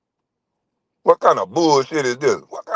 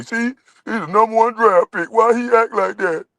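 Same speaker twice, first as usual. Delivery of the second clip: high nasal-free mock whine of a critic, pitched way up; 9 semitones higher than usual. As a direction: sneer at the critics in a high put-on voice. Mocking critics' whiny voice.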